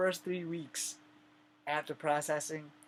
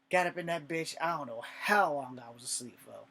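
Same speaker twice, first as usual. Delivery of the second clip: normal talking voice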